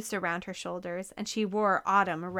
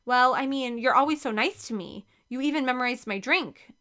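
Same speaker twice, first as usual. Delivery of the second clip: ordinary delivery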